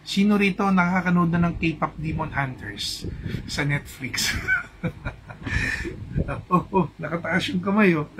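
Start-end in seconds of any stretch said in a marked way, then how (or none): 4.4-6.4 high breathless laugh. Real, not acted. Laughing at own question.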